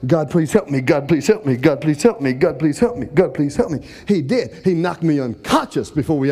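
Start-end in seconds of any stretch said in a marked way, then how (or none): 0.1-3.8 low, rapid-fire repeated prayer; imitating a panicked prayer on a loop; desperate praying. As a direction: chant the plea over and over without a breather. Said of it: "I started praying".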